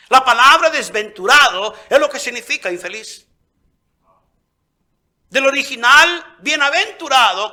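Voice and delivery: loud, high preacher's fervor; fiery preaching. Acted. Preacher exhorting the church, loud fervent sermon. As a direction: preach it hard to the congregation.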